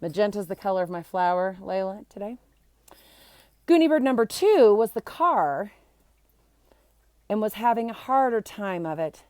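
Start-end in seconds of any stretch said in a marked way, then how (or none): none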